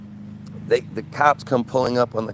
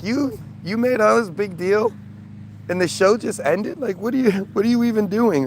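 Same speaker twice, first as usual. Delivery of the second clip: incredulous retort; 6 semitones higher than usual. Retold or imitated. Mocking the show's organizer.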